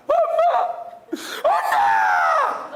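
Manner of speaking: drawn-out, very slow wailing shout of "엄마"; a daughter crying out for her dead mother; loud, hammed-up mourning wail; daughter's wailing cry. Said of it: "딸은 엄마 돌아가시면 이렇게 웁니다".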